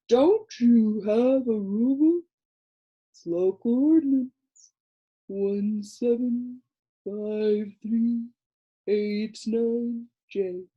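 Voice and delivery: slow, drowsy creature drawl; a dull little creature explaining to Milo, slow and sleepy; sleepy Lithargian. A narrator's reading. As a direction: drone it lazily like a half-asleep creature. Creature speaks.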